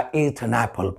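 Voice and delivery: British English accent, quietly. Shown as a demonstration. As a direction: say it the British way, joining an to apple. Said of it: "और वो लोग बोलते हैं".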